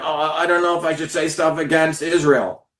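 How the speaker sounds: a hint of a timid, hedging voice; mock quivering coward. Mocking people too scared to criticise Israel, with a hint of timid hedging. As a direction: lightly mimic someone too scared to speak. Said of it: "Everyone else is quivering".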